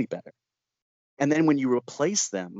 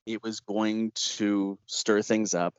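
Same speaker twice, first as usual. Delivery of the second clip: normal talking voice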